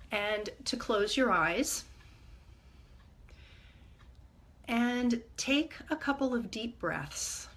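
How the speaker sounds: calm, measured meditation guide with long pauses; guided meditation. Acted. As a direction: ease listeners gently inward, leaving space between phrases.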